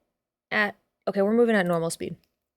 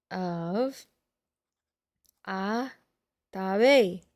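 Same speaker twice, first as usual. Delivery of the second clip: slow dictation; 5 semitones lower, 6 dB quieter, much slower than usual. Real, not acted. Dictating name while writing.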